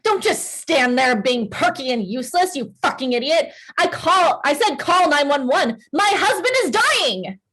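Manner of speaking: panicked, furious rant climbing high; frantic fury. Acted. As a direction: berate the waitress, building to a high desperate cry. Frantic wife berates waitress for help.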